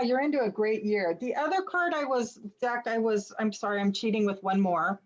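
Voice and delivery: usual speaking voice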